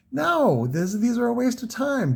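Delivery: high, incredulous mock protest; emphatic dismissal. Seller retells telling Larry he never sold kits, mock dismissive. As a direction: imitate an exasperated denial, pitch way up.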